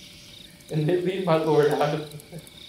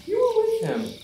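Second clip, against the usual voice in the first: sharp, high accusing voice, climbing to a very high peak; a servant girl calling him out, much higher; 9 semitones higher than usual. Acted. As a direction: point the finger at him.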